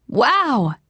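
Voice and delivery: high, excited call across the table; hollering. A narrator's reading. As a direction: holler it to a friend. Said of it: "Kara hollered across to Charlotte".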